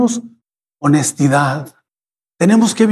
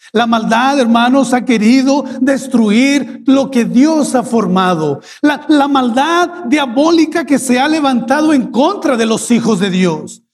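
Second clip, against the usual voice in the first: a touch of preaching build, higher; rising sermon; 4 semitones higher than usual. Acted. Pastor warns the church of evil, rising preaching. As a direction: lift the sermon, climbing.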